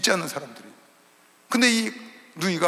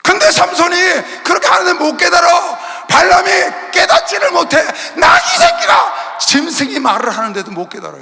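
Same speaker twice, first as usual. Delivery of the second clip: high, loud, slow hammering sermon; shouted rebuke to the congregation; fiery preaching; 10 semitones higher, 9 dB louder, slower than usual. Acted. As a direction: thunder it from the pulpit. Preaching at full force.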